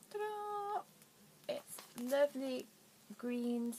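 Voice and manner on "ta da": high, sing-song ta-da; bright reveal of the yarn. Real, not acted. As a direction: present it like a magic trick.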